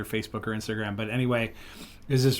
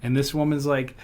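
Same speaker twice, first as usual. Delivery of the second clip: ordinary talk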